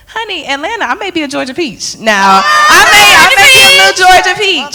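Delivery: high loud proud exclaim; hammed-up Georgia peach pride; proud declaration. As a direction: belt it out with Southern pride. Proud Southern belle flourish.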